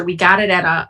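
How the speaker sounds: usual speaking voice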